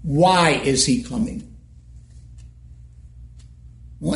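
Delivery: ordinary delivery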